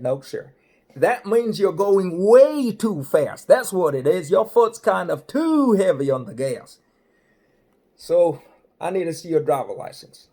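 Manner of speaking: hammed-up highway patrolman; state trooper voice. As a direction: play the big-voiced cop laying down the law. Trooper lectures about speeding.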